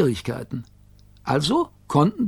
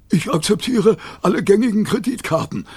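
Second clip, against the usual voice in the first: higher, businesslike dog; reply brightly as the dog, pitched up; talking dog voice; 7 semitones higher than usual. A narrator's reading. Rover accepting the Gigglerin's bribe, higher voice.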